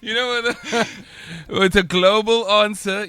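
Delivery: words spilling out through laughter; laughing. Real, not acted. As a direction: laugh while you say it, amused. Laughing at own global joke.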